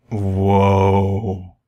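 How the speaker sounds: ordinary delivery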